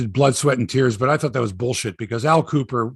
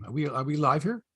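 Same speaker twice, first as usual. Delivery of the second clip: ordinary talk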